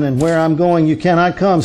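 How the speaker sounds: normal talking voice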